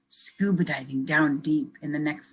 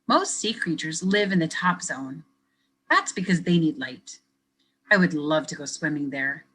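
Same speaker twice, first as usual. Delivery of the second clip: lively picture-book reading; reading to children. Acted. Teacher reading a picture book to kids, lively storytelling. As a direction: read it brightly for young listeners.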